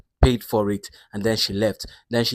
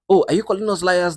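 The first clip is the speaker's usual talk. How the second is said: high, louder mock offence; indignant retort; 9 semitones higher, 5 dB louder than usual. Diners answer the waiters' denial, indignant. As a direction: act affronted at being doubted.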